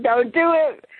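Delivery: ordinary talk, nothing marked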